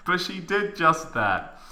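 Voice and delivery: normal talking voice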